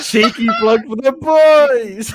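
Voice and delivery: high, slow, laughing through words; giggling as he says it. Real, not acted. Laughing while plugging.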